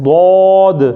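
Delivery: ضاد held as a heavy "dwad"; Arabic ضاد. Shown as a demonstration. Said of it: "اہل عرب اس کو کہتے ہیں ضاد".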